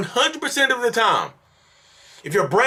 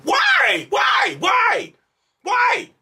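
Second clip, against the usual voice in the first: shrill, much higher yelled whys; exasperated shout; much slower than usual. Real, not acted. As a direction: scream the question in disbelief. Exasperated at viewers' bad choices.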